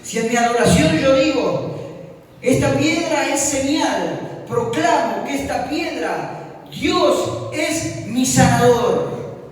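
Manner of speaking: high, slow, emphatic declaration; preaching proclamation. Real, not acted. Preacher modelling a declaration of faith, slow and emphatic.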